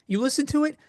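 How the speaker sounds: usual speaking voice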